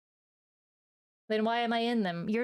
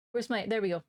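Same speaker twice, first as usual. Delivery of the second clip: ordinary talk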